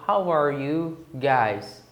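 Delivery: slow, careful model phrase; slow example. Shown as a demonstration. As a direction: spell out each word slowly. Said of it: "Por exemplo". (frase modelo bem devagar)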